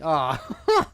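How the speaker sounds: sudden loud cry of dismay; blurted, startled ah. Real, not acted. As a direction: yelp as something spills mid-sentence. Spills latex mid-answer.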